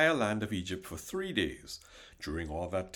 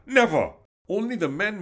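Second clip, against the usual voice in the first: Pharaoh's furious shout of refusal, higher and louder; angry shout; 10 semitones higher, 9 dB louder than usual. Acted. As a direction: bark it out as an enraged king. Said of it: "Pharaoh retorted".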